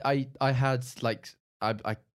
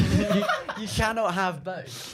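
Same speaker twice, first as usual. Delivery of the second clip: laughing; 11 semitones higher, 7 dB louder, slower than usual. Real, not acted. Laughing while repeating point.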